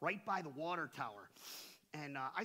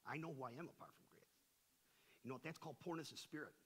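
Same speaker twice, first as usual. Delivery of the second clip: ordinary delivery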